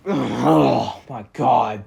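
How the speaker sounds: long, drawn-out groan of despair; dismayed groan. Acted. Reviewer faced with a hated prop, dramatic dismay. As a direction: groan it out very slowly.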